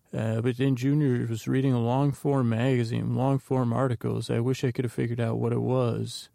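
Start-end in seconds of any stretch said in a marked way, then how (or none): none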